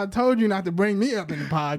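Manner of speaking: normal talking voice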